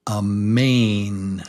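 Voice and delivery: slow, drawn-out "mane"; slow teaching model; slow model word. Shown as a demonstration. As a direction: stretch it out for learners. Teacher introduces new word.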